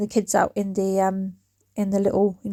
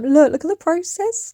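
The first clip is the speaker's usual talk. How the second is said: excited; 7 semitones higher than usual. Real, not acted. Showing off the painted beams.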